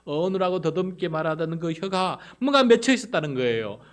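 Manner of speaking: loud, high, forceful pulpit delivery; full-force preaching. Real, not acted. Preacher explaining the bound tongue to congregation, full force. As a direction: drive it home at the top of the voice.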